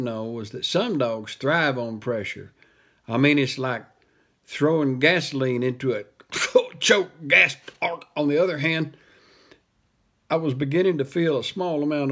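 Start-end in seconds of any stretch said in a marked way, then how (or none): 4.5-7.7 choking gasp. Acted. Choking on his words.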